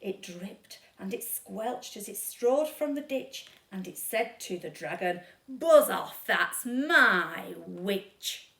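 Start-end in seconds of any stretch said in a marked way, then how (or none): 5.5-8.5 much louder, then drawn out very slow and low; horrible beast. Acted. Beast warns dragon off, loud slow growl. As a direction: roar it like a four-headed monster. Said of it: "a yowl and a growl".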